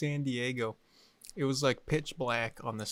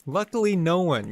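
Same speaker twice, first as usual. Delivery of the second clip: mock game show reveal; 5 semitones higher, 6 dB louder than usual. Mock quiz host reveal.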